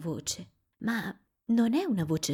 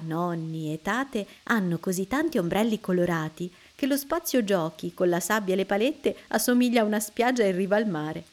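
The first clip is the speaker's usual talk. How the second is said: plain reading voice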